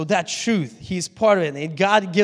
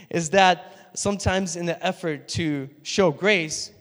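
ordinary talk, nothing marked

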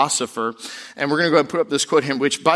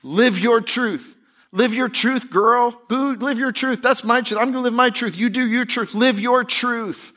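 much higher, sassy hype voice; sassy cheerleader; 10 semitones higher than usual. Retold or imitated. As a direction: hype a friend up in a high, sassy put-on voice. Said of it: "Fake news is in our kids' movies".

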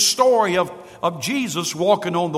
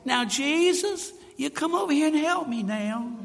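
casual request; 7 semitones higher, 3 dB quieter than usual. Acted. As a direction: ask as if there were no emergency at all. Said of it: "I don't think he said".

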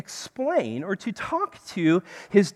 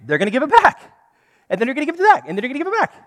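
building comic repetition; 5 semitones higher, 6 dB louder, much faster than usual. Pretend. Comic escalating back and forth.

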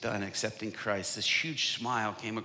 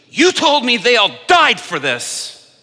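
shout; 13 semitones higher, 17 dB louder than usual. Retold or imitated. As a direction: shout it, shaking the Bible. Hitler shaking Bible, shouting.

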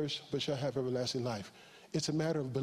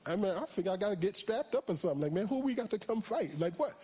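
excited reply; 8 semitones higher than usual. Retold or imitated. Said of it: "I'm like".

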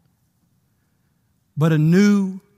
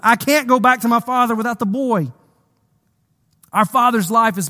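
higher-pitched, urgent pleading; earnest plea; 6 semitones higher, faster than usual. Acted. Judah begging Joseph for the boy, urgent plea. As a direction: plead as if lightly acting it out.

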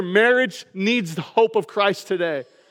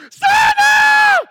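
very high, loud ecstatic scream; Will Ferrell in Elf; 8 dB louder than usual. Retold or imitated. Elf hears Santa is coming, ecstatic scream. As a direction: scream it with wild, childlike excitement. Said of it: "his excitement for Christmas".

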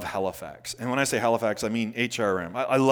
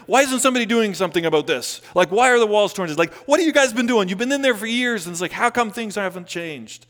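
mock exasperated complaint; 10 semitones higher, 8 dB louder, faster than usual. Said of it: "He doesn't say, he's like".

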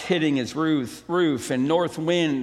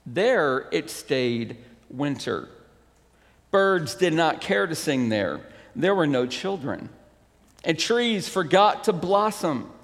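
normal talking voice

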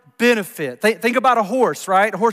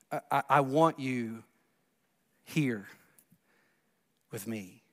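hushed tender; 7 semitones lower, 12 dB quieter than usual. Acted. Intimate voiced quote of Jesus.

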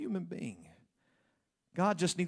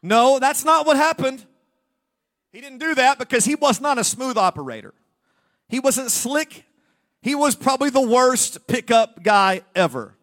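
higher, much louder declaration; emphatic preaching; 8 semitones higher, 10 dB louder than usual. Real, not acted. Preacher denying Adam was smooth, loud and high. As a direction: proclaim it to the room.

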